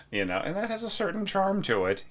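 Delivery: jokingly nervous hedge, voice jumping up. Acted. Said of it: "he says nervously".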